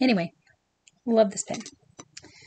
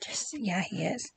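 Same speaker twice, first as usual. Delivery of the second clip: whisper; 2 semitones lower, 10 dB quieter than usual. Acted. Gossiping about villain.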